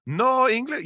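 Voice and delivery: slow, high mock German accent; playing dumb in German. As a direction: act the baffled German tourist. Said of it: "he did the whole thing".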